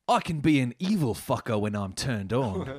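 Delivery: put-on British accent; Andrew Griffiths. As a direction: read it as a lecherous British politician. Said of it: "This is British M P Andrew Griffiths".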